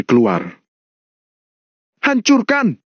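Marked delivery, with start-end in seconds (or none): 2.0-2.8 high-pitched battle order; commanding. Acted. Kasman orders his men to smash everything, commanding. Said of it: "Begitu Kasman memerintah".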